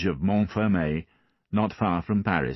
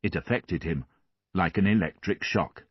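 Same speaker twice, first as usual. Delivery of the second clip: usual speaking voice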